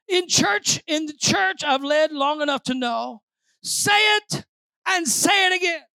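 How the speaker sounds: high, insistent preacher's call; forceful preaching. Real, not acted. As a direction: drive each instruction home to the congregation.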